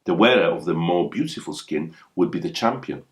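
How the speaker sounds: plain reading voice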